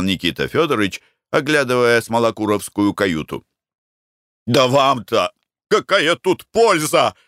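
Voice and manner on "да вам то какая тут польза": agitated, higher-pitched challenge; heated. A narrator's reading. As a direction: argue hotly, leaning on the words. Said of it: "горячился Марко Данилыч". (горячась)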